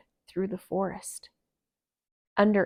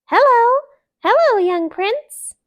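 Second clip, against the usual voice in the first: very high, loud welcome; excited greeting; 13 dB louder than usual. Acted. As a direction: greet the newborn with delight. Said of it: "who called to him".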